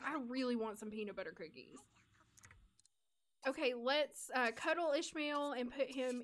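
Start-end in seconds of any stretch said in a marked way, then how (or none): 0.1-1.8 mock craving. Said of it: "I'm like".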